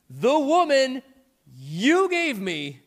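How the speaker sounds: blaming Adam. Acted. Adam throws his wife under the bus, accusing. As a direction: point the finger hard at her.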